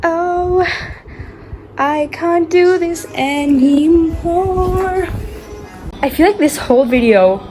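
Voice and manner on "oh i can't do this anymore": ordinary talk, nothing marked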